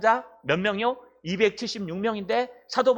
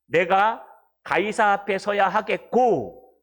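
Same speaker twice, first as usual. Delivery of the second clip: slow reading; 4 dB louder, slower than usual. Acted. Reading scripture slowly.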